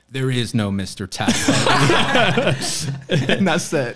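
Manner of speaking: slow, laughing delivery; quoting the lady with a laugh; laughing punchline. Retold or imitated. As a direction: stretch out the punchline, cracking up.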